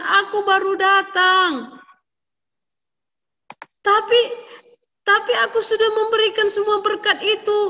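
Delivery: high, urgent and loud, tumbling out; flustered. A narrator's reading. Father realises the blessing went astray, high and flustered. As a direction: panic rising as the truth dawns. Father and son confused exchange.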